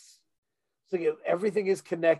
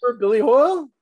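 excited; 7 semitones higher, 8 dB louder, faster than usual. Real, not acted. Teasing co-host with a reminder.

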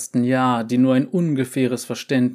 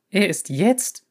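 high startled question; 7 semitones higher than usual. A narrator's reading. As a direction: jolt up in alarm.

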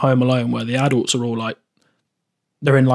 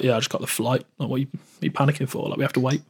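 nonchalant dad; 9 dB quieter than usual. Retold or imitated. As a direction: imitate an unbothered dad, softly. Mimics dad's casual, unbothered reply.

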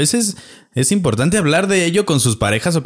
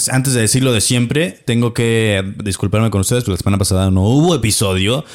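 normal talking voice

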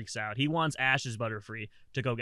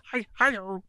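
Kermit the Frog voice; 5 semitones higher, 5 dB louder than usual. Acted. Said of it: "Now do a Kermit the Frog voice".

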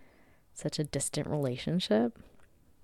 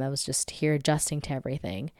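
ordinary talk

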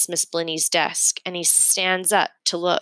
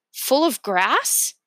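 slow unsure guess; 7 semitones higher, much slower than usual. A narrator's reading. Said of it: "So I say".

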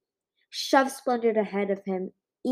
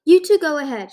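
urgent; 7 semitones higher, 11 dB louder than usual. A narrator's reading. Urging them to flee.

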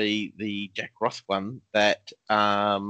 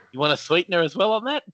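laughing; 6 semitones higher, 4 dB louder, faster than usual. Real, not acted. Laughing at absurd trade.

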